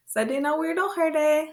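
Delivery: "Sa de na weirdo herde" quick, high and lilting; quick natural version. Shown as a demonstration. Teacher showing real use with a child, quick high call. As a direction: run "Sa de na weirdo herde" together in one high sweep. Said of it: "That's how it would be. very quickly".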